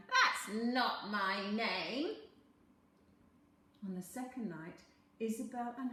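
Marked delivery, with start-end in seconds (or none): 0.1-2.2 a lightly voiced scheming little man, slow and loud; sly little man. A narrator's reading. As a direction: bargain like a crafty imp.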